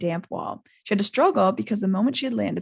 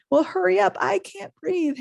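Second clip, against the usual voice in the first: higher, strained gasp for air; 11 semitones higher than usual. A narrator's reading. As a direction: choke it out as if squeezed. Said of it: "Harry gasped".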